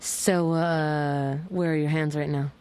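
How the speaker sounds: low, teasing phone flirt; a little sexy. Pretend. Host flirting with a caller about hands, playfully sexy. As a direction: play at seduction, pitched low. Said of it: "it was actually a little sexy".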